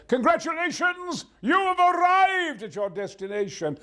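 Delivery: high, slow, booming proclamation; hugely hammed-up announcement; triumphant sat nav. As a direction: herald the arrival at full voice. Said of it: "then I suddenly will say".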